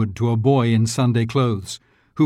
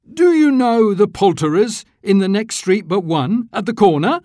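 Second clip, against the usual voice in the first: higher, louder, eager question; eager old Scrooge; 9 semitones higher, 3 dB louder than usual. A narrator's reading. Scrooge asking the boy about the poulterer, eager. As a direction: lean out and ask keenly.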